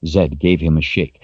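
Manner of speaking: usual speaking voice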